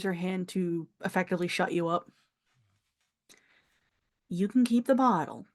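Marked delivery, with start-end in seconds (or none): none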